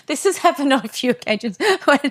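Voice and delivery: laughing. Real, not acted. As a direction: laugh your way through the confession. Laughs telling an embarrassing story.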